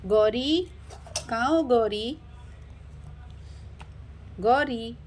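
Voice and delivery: calling out for someone, drawn-out name. Acted. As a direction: call to someone out of sight. Looking for Gauri, calling out. (किसी को ढूँढते हुए पुकारना)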